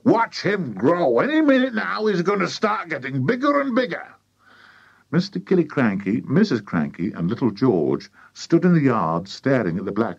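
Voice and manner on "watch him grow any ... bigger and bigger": high, excited build-up; excited cry. A narrator's reading. Mister Cranky promising the bird will grow, excited cry. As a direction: crow it high with mounting excitement. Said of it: "cried Mister Cranky".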